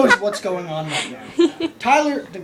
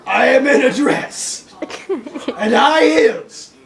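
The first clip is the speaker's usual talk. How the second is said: loud, hammed-up indignant rant; emphatic, outraged diva telling-off; mock tirade; 3 semitones higher, 4 dB louder than usual. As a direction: lay into him at full volume, playing it for laughs. Mock tirade at forgetful friend.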